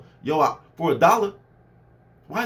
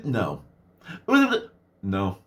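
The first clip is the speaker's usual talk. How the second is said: deadpan no; 10 semitones lower than usual. Retold or imitated. Deadpan refusals.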